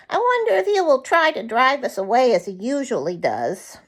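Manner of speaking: a frozen bird glad of food; nuthatch voice. Acted. Yank Yank the nuthatch speaks.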